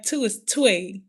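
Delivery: "two" turned into "twee", like "tway"; imitated Memphis pronunciation. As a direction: try out "twee" as the accented "two". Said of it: "how do they say".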